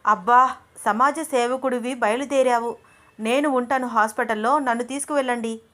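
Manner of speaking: jealous girlfriend mocking him, words pressed hard; pressing every word. A narrator's reading. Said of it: "ఎందుకలా నొక్కి నొక్కి మాట్లాడుతున్నావు".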